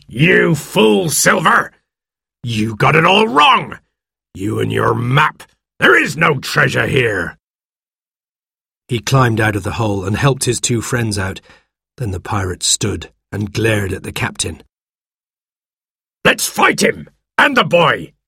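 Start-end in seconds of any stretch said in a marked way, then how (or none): 0.1-7.4 high outraged scolding, easing toward the end; angry shout. A narrator's reading. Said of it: "he shouted". 16.2-18.1 high, fierce pirate call; aggressive shout. A narrator's reading. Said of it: "he shouted".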